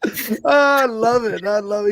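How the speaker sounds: very high, drawn-out, laughing delight; delighted laughter. Real, not acted. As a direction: crack up while saying it. Delighted at guest's joke.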